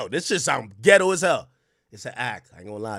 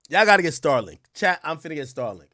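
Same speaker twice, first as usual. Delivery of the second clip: ordinary talk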